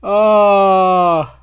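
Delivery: long, loud groan, pitched high and falling; drawn-out loud "oh" of dismay. Real, not acted. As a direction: groan out the defeat. Groans after losing the race.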